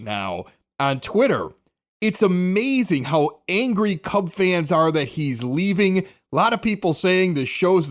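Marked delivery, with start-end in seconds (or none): none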